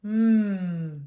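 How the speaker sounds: loud, savouring mmm of delight; pleased mmm. Acted. Girl sniffing her smelly sock, delighted mmm. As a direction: relish the smell. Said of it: "the girl would say in pleasure".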